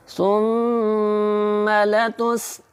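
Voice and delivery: very slow melodic recitation, nasal hum on the doubled nun; slow Quran chant with nasal ghunna. Shown as a demonstration. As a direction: draw out لترون with a humming nasal. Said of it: "لام کو جلدی سے پڑھنا ہے".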